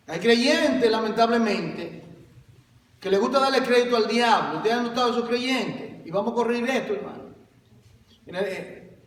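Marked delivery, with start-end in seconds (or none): none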